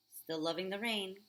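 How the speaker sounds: usual speaking voice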